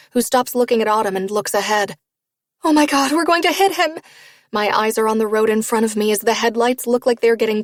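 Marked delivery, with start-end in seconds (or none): 2.6-4.0 high, loud panicked cry. Acted. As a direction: scream in sudden terror. Oncoming headlights, panic.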